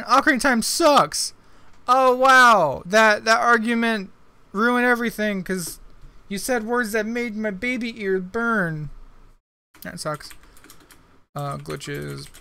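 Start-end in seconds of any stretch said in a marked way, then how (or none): 0.0-1.3 mock insult. Reads the insult aloud. 1.9-8.9 high, loud, slow mock offense; hammed-up wounded fan; mock outrage.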